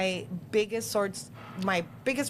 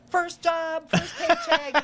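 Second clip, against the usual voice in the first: put-on proud kids posting; 9 semitones higher, 6 dB louder, much slower than usual.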